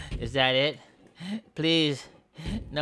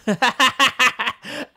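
high, loud burst of laughter; playful high cackle; gleeful cackle; 8 semitones higher, 5 dB louder than usual. Pretend. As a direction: cackle with glee at the destruction. Gloating over wrecking the building.

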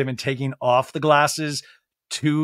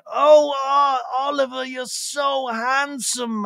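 high, drawn-out gushing impression; Lisa Vanderpump; 8 semitones higher, slower than usual. Lisa admiring a wolf, gushing impression. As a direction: coo it slowly in a high put-on voice. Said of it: "We hear Lisa Vanderpump going".

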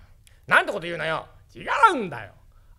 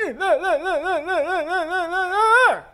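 toothless ancient grandpa; 10 semitones higher, 4 dB louder than usual. Acted.

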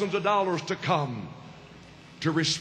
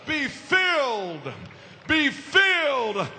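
high-pitched, driving revival sermon; full-force preaching; 6 semitones higher than usual. Real, not acted. Preacher urging the audience to receive the Holy Ghost, full-force preaching.

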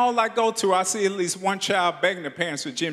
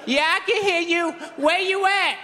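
put-on high excited kid voice; kid on walkie-talkie; 9 semitones higher than usual. Brother answering over the walkie-talkie, high and eager.

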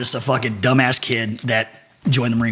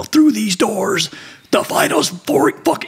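booming drill instructor; 9 semitones higher, slower than usual. Retold or imitated. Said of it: "they're like".

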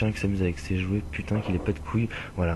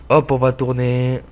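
slow, louder sing-song call; louder, slowed-down turning call; drawn-out announcement; 3 semitones higher, 6 dB louder, slower than usual. Real, not acted. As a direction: stretch it out as you turn the wheel. Same words said twice.

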